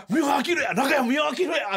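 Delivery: high, loud thrilled cry with a laugh; excited shout. Retold or imitated. Comedians spotting a celebrity at the station, excited shout. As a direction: shout it like spotting a lucky star.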